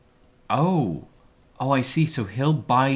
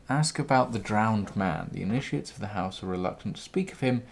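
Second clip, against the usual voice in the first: ordinary talk, nothing marked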